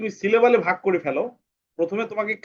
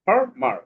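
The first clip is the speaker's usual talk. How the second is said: "ফার, মার" higher, as two distinct beats; separated syllables; 8 semitones higher than usual. Shown as a demonstration. Teacher giving an example of syllables, "ফার, মার" separated and higher. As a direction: set "ফার" and "মার" apart. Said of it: "তাহলে ফার একটা অংশ".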